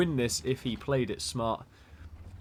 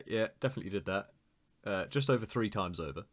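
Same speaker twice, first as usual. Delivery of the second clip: ordinary delivery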